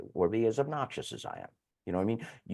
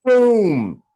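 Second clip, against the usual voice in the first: emphatic boom; 11 semitones higher, 11 dB louder than usual. Real, not acted. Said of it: "emphatic exclamation".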